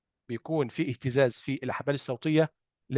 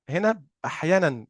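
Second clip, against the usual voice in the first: normal talking voice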